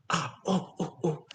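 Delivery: ordinary talk, nothing marked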